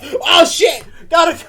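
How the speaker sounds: high, loud burst of mock alarm; mock panic shout. Host acting out panic at a popped balloon, mock shout. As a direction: yell it like a startled overreaction. Acts out reaction to balloon pop.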